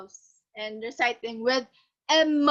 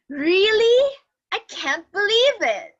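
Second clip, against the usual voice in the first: higher and louder, disbelieving "Really?"; surprised; 7 semitones higher, 6 dB louder than usual. Shown as a demonstration. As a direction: show real shock at the news. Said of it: "expressions that show surprise".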